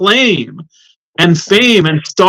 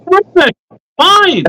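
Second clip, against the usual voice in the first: mock cheerful exclamations, much higher; sarcastic cheer; 12 semitones higher, slower than usual. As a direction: chirp it with fake, over-the-top enthusiasm. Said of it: "And I say to that".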